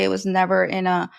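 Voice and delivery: ordinary talk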